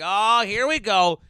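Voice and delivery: slow, high, loud hammed-up yell; drawn-out mock cheer; mock hype shout. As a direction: belt it like a rowdy fan. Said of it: "I'm like".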